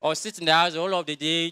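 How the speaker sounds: ordinary delivery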